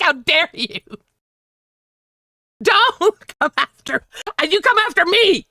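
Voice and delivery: hammed-up affronted challenge; mad, indignant. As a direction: replay the outrage with a high, incredulous voice. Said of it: "I was so mad. I'm like".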